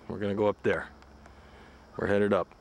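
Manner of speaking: normal talking voice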